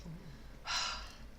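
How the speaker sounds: long unvoiced sigh, falling away; breathy sigh of relief. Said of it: "breathed a sigh of relief".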